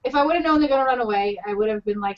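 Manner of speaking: ordinary talk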